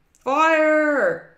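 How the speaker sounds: loud, high alarm yell; drawn-out shout of alarm. A narrator's reading. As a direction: holler a fire warning. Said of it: "yelled Jerry".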